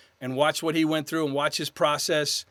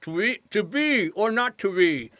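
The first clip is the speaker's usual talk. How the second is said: slow, high articulation drill round a pencil; exaggerated mouth work, pitched up; 5 semitones higher, slower than usual. Shown as a demonstration. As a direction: recite Shakespeare with a pencil held in the mouth. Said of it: "I'd take the pencil".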